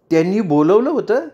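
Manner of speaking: होतं lifted high into an amazed question; surprised echo. Acted. Rajanitai echoing Chandrakala's news in astonishment, surprised rising question. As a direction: echo it back, unable to believe it.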